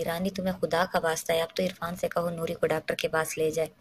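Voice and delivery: plain reading voice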